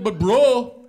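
loud, high whine; pleading protest. Retold or imitated. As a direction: protest loudly to your buddy. Roommate protests.

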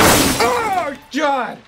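sudden high yelp of fright; real jump scare yelp; startled cry. Real, not acted. Startled by the baby.

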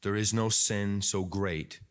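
low, measured praying voice; subdued, reverent address to God; closing prayer. Real, not acted. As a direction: pray earnestly, phrase by phrase.

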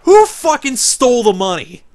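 loud, high outraged yelling at the screen; furious shout. Real, not acted. Gamer yelling at the game's choice results in fury. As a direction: blow up in disbelief.

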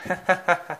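short high chuckle. A narrator's reading. James laughs at sleepless Thomas, a short chuckle. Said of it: "he chuckled".

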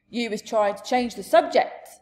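loud, stern rebuke; stern giant voice, projected. Acted. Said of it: "the giant said sternly".

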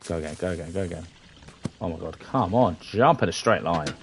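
ordinary talk

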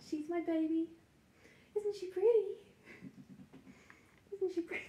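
high cooing voice for the puppy. Real, not acted. Owner coos over her new puppy in high baby talk.

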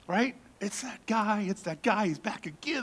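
ordinary talk

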